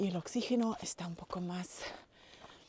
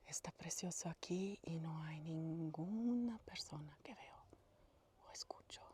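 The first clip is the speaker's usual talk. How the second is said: very soft whisper at the lake; hushed whisper; 2 semitones lower, 13 dB quieter than usual. Real, not acted. Alone at the lake with viewers, a hushed whisper. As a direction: barely breathe it out.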